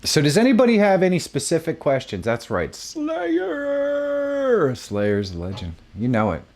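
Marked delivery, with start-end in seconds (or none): 2.8-4.7 excited. Real, not acted. Shouting band name, excited.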